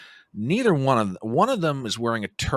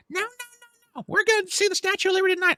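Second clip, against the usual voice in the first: high, rapid-fire overruling voice; mock insistent sister; faster than usual. Sister overruling her brother's travel plan, mock insistent. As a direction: imitate a sister shrilly vetoing the plan.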